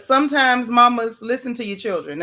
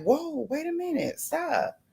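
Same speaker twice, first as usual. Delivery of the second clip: soft, higher startled inner voice; inner stop; 5 semitones higher, 7 dB quieter than usual. Retold or imitated. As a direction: pull yourself up short, softly.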